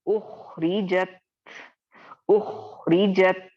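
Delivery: उखरिजत slow with a firm, thick ख; moderately heavy ख in उखरिजत; second-level heavy. Shown as a demonstration. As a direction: model उखरिजत for the class. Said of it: "अब इसको हम कैसे पढ़ेंगे?".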